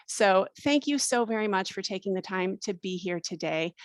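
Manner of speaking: ordinary talk, nothing marked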